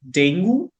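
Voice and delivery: "dengue" as "DENG-goo"; local pronunciation. Shown as a demonstration. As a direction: end "dengue" on an oo sound. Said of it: "I call it dengue".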